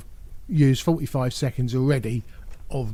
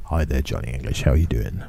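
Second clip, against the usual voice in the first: much lower put-on voice, same level; mock Johnny English greeting; 13 semitones lower, faster than usual. Vlogger imagines meeting Rowan Atkinson, lower mock greeting. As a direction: greet him like a suave secret agent.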